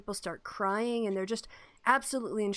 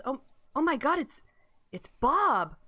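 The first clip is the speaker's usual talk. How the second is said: gasping shock; 4 semitones higher than usual. Acted. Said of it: "you hear a gasp of someone gasp and says".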